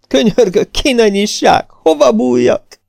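very high, frantic begging; terrified squeal. A narrator's reading. Hiding man begs for shelter, high squealing panic. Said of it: "visította".

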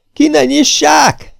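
very high, slow, loud wail; terrified shriek. A narrator's reading. Hiding man begs them not to open up, shrieking. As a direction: shriek it in panic. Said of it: "visította".

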